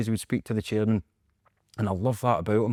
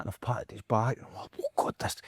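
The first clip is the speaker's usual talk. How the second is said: awed whisper; 8 dB quieter than usual. Retold or imitated. Awed whisper after patting his back.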